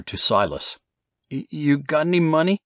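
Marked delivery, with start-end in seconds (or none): 1.3-2.6 high, hesitant surfer-dude voice; stammering stoner request; sheepish surfer ask. Acted. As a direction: cadge cash from Silas. Casey begging money.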